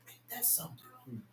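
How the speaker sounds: unvoiced hush of wonder; whisper. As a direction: whisper it to the congregation. Preacher whispers then shouts phrase.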